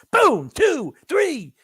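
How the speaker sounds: high, loud shouted count; excited shout counting the blows; counting punches. Real, not acted. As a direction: yell out each punch as it lands. Counting punches on fight replay.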